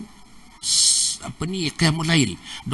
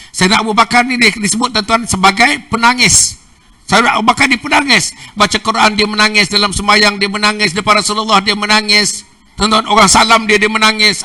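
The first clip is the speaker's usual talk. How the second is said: loud, high, fervent preaching; preaching at full force; 8 semitones higher, 9 dB louder than usual. Real, not acted. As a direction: press each line hard to the crowd. (ceramah berapi-api dengan suara lantang)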